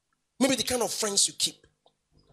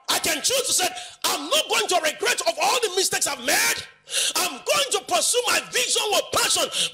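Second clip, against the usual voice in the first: high, defiant declaration of resolve; impassioned preaching; 10 semitones higher than usual. Acted. Preacher modelling a refusal to regret mistakes, impassioned. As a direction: declare it from the pulpit in a raised pitch.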